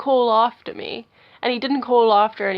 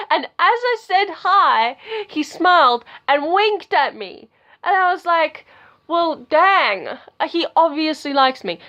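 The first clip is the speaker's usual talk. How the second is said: high, loud, giddy retelling; excited shouting; 7 semitones higher, 6 dB louder than usual. Real, not acted. Telling viewers about his wink, shouting with excitement. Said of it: "sorry if I'm shouting".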